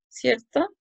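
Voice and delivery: ordinary talk, nothing marked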